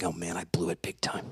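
low, soft muttering to himself; deflated, under the breath; hushed regret. Retold or imitated. Boy alone in his room after the outburst, hushed regret.

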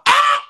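high shrill shriek of outrage; sharp shriek. Retold or imitated. Hearing the ex's excuse, a loud outraged shriek. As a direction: let out a loud outraged scream.

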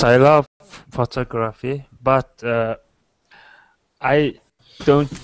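ordinary talk

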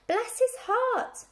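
high, loud, slowly drawn-out cry; crying out fondly. A narrator's reading. Sheep greet the little pig, crying out high. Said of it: "they cried".

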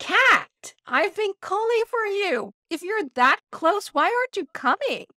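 high, loud calling out to a cat. Retold or imitated. Calling out for the missing cat, high and loud. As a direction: call across the gardens for the cat.

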